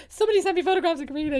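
high, loud mock-desperate call; frantic plea. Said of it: "I was like, on the day going".

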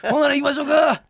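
Miura Mild impression. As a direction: kick off the round like a Kansai emcee. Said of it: "あー、マイルドさんだ。".

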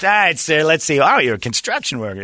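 high, eager salesman. Retold or imitated. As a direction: play the pushy sales rep.

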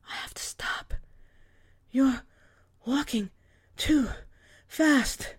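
breathless whisper rising into a plea; panicked, winded mother; out of breath. A narrator's reading. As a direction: gasp it out like you can't walk further.

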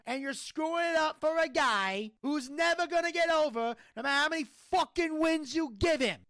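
worked-up mobster-style tirade; fed-up rant. Real, not acted. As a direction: rail in exasperation, wiseguy accent. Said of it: "I'm fucking fed up".

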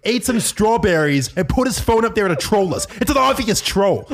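high, worked-up accusation with laughter; laughing, amped-up rant about strawberries; excited rant. Retold or imitated. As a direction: push the theory hard and high. Keem ranting accusation.

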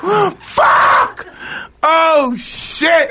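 high shouted squeals of pain; high yelping mock agony; mock pained scream. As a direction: scream like it's happening to you. Imagining liposuction, screaming.

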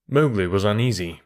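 ordinary delivery, nothing marked

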